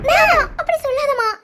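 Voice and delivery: desperate plea. Acted. Wife begs doctor about husband.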